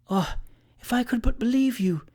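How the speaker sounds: high, coquettish female voice; coy, high-voiced doubting. A narrator's reading. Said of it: "said a female voice coquettishly".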